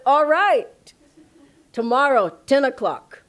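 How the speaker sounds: loud, high mock-exasperated consent; exasperated giving in. Guru finally yields to the insistent man, exasperated and loud. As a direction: give in loudly, each piece set apart.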